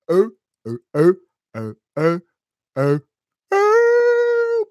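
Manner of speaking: short loud "oh"s into a long high squeal; hammed-up pumped-up hoots; loud flexing whoops. Flexing noises during hype intro.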